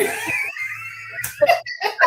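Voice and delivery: very high, shouted burst of laughter; shrieking laugh. Real, not acted. Host picturing her grandfather's disbelief, shrieking with laughter. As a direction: let out a delighted shriek of a laugh.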